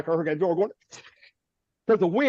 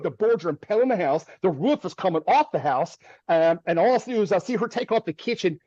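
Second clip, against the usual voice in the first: ordinary talk, nothing marked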